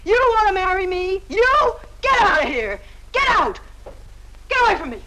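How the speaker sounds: high-pitched angry jeering, rising to a yell; furious taunting. Acted. Woman ordering her suitor out, furious and scornful.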